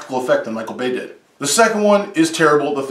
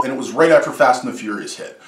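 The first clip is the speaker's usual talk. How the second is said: ordinary talk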